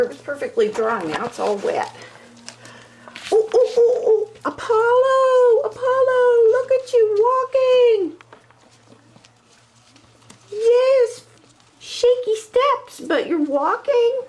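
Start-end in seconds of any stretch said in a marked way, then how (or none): 3.3-8.2 much higher, louder, slow cooing at the puppy. Real, not acted. Owner cheering her puppy's first steps, high excited baby talk. 10.5-14.2 much higher, slow sing-song encouragement; cooing praise. Real, not acted. Owner praising puppy's shaky steps, high cooing voice.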